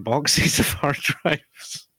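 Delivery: amused, slow delivery with laughter breaking in; laughing. Real, not acted. Telling a friend about the three boxes of hard drives, laughing. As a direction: tell it through a laugh.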